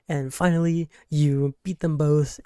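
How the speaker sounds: ordinary talk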